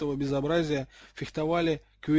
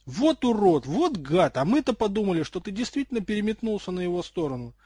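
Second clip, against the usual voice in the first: loud, high, relieved outburst; joyful yell; 5 semitones higher, 6 dB louder than usual. A narrator's reading. Ron realises his friend was only pretending, joyful yell. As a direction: whoop out the insults with delight. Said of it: "явно радостный вопль".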